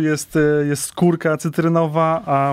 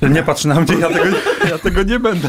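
laughing; 2 semitones higher than usual. Real, not acted. Laughing denial.

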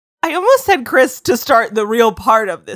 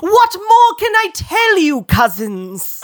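theatrical speech; 7 semitones higher, slower than usual. Acted. Edward's speech read dramatically.

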